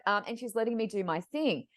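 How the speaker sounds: normal talking voice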